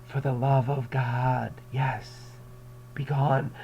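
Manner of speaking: slow, cold mimicry; cold mocking echo. Teacher voicing Montresor mocking Fortunato, slow. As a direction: throw his plea back with menace. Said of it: "You know".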